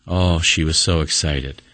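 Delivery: normal talking voice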